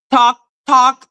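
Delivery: ordinary delivery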